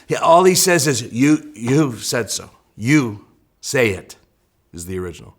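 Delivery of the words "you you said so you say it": YOU set apart, slow and low; slow, lowered delivery with weight on "You"; stress on YOU. Shown as a demonstration. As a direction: lean on the word You, drawing it out slowly. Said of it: "with the emphasis on the you".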